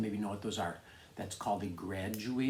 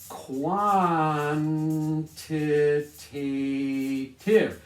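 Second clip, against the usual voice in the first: Quantitative stretched very slowly and louder, every syllable; slow, drawn-out word; 3 semitones higher, 6 dB louder, much slower than usual. Shown as a demonstration. Teacher drilling the new word for students, slow and stretched.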